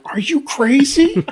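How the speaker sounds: high, slow bewildered bear; bear's disbelief. Retold or imitated. Bear staring at grandfather, high bewildered disbelief. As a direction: play the baffled bear. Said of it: "the bear looks at him like".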